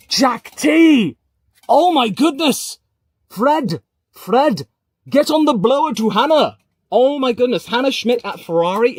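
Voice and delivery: real shock, shouting high and loud; excited shouting. Real, not acted. Streamer hearing shock transfer news, calling out excitedly. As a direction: yell it like the news just broke.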